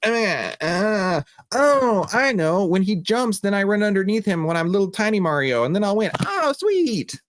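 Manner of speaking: put-on high child voice, dawning delight; excited kid discovering. Kid flipping through the game guide, thrilled realization. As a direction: puzzle it out, then squeal with glee. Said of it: "and you're like".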